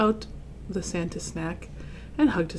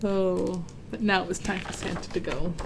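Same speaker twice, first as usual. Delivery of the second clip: ordinary delivery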